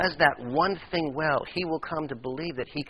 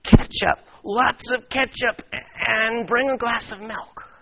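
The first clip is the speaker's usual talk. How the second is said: amazed delight; 10 semitones higher, 8 dB louder, slower than usual. Acted. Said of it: "He flashed an amazed smile".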